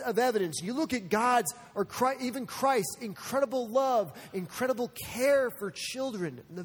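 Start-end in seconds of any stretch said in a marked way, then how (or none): none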